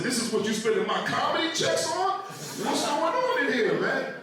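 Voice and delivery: high-pitched mock outrage; upset husband. Upset husband questioning his wife over spending, mock outrage. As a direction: demand an explanation, voice shooting up high. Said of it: "And I was so upset".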